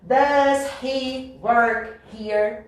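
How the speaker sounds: DOES HE WORK HERE very slowly, each word separate, H sounded; reading word by word. Shown as a demonstration. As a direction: read DOES HE WORK HERE out neatly, unlinked. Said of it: "ler bem bonito".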